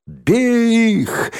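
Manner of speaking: very high, drawn-out yell; rally the gang to charge; high, stretched battle call; attack cry. Acted.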